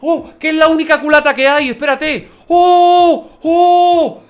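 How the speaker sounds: high, loud whoops of real delight; yelling with real excitement at a lucky drop; excited shouting. Real, not acted. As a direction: shout out in joyful disbelief. Finds the rare stock he lacked.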